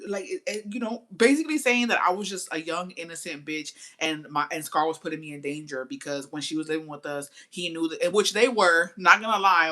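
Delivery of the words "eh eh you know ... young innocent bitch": usual speaking voice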